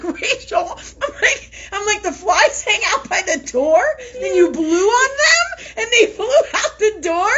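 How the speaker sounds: gasping the question through uncontrollable laughter; hysterical laughter. Real, not acted. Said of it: "I couldn't stop laughing".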